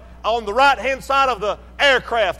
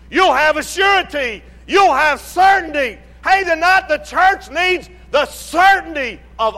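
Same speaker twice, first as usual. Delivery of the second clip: rising preacher's cry, louder on the peaks; preaching; 3 semitones higher, 3 dB louder than usual. Acted. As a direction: preach it out to the congregation. Preaching at full force.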